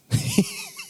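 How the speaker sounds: a sudden high squeal of laughter; high squeaky laugh. Real, not acted. Pastor squeals with laughter at the greeting. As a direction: let a tiny high giggle escape.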